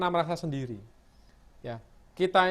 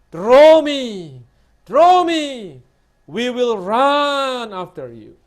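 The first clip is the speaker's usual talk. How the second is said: seruan sangat keras, akhirnya melambat; seruan memohon; 3 semitones higher, 11 dB louder, much slower than usual. Acted. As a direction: berseru keras lalu tarik panjang. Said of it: "Dia ada satu permintaan". (seruan permohonan yang keras dan lambat)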